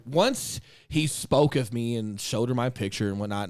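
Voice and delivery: ordinary talk, nothing marked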